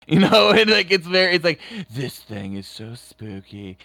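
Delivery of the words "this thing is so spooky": low, hushed, slow British narrator; effeminate creepy British. Retold or imitated. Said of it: "a very effeminate British voice".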